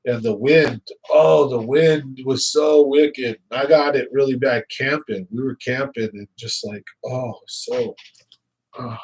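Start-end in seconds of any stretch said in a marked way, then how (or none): 1.1-3.4 slow, drawn-out lament; exaggerated complaint. Real, not acted. Telling the chat about windy camping, slow drawn-out lament. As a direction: stretch it out like it was brutal.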